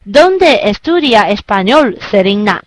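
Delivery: slow, ends low on Selena; falling tune, slow. Shown as a demonstration. Teacher repeats the correct low ending. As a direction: let Selena drop low. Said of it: "以低调收尾的句式".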